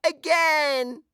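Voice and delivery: put-on very high, squealing baby delight. A narrator's reading. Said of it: "The baby laughs and shouts".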